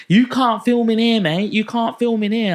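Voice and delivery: high, loud barking at the customer; retold shout. Shop manager yelling at a customer holding up a phone, loud. Manager stops him filming.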